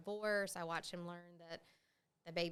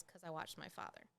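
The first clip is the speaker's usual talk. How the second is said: a hint of the alcoholic brother, much softer; 3 semitones lower, 9 dB quieter than usual. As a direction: drop it softer, like the brother who went the other way. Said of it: "And then the alcoholic, his answer was".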